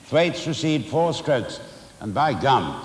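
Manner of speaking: usual speaking voice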